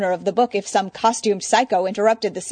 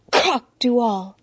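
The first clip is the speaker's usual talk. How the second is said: coughing the words; coughed words; 4 semitones higher, 4 dB louder, slower than usual. Acted. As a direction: cough it into a napkin. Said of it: "Zatanna coughed into her napkin".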